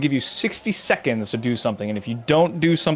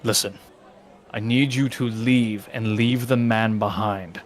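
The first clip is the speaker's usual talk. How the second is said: menacing guard voice; 5 semitones lower than usual. Acted. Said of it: "The guy leans in and says".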